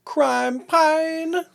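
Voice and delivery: high-pitched goofy voice; high silly voice. Acted. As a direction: squeak it out playfully. Echoing discount code in silly voice.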